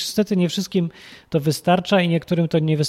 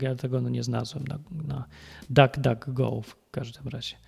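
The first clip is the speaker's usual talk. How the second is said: normal talking voice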